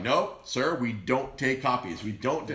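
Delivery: slow, loud, firm clerk; firm refusal. Retold or imitated. DMV worker refusing the copies, slow and firm. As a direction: spell out the rules to him.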